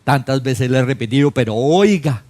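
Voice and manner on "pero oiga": louder exasperated Pero oiga; raised voice. Retold or imitated. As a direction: snap it with a raised voice. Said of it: "subir el tono de voz". (voz alzada, exasperada)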